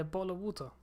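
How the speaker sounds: "bottle of water" with the T in "water" sounded; T pronounced. Shown as a demonstration. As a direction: softly say "water" with a real T. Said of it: "We don't say water".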